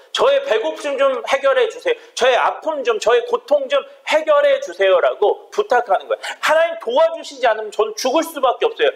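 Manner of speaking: high, pressing preacher's plea; impassioned preaching. Acted. Preacher voices a desperate plea to God, impassioned. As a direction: cry out the psalmist's prayer for the congregation.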